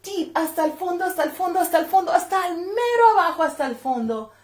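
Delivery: high and fast, hasta el fondo; excited build in Spanish; building suspense. A narrator's reading. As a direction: press on eagerly to the bottom. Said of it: "reaching deep into the dog".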